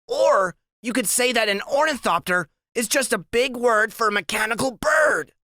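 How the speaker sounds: high, precise little-genius voice reciting a definition; Freak's know-it-all voice. A narrator's reading. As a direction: lecture like a walking dictionary, high-pitched. Narrator voices Freak lecturing.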